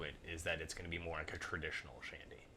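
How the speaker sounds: hushed whisper. Real, not acted. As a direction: drop to a whisper. Whispered prediction.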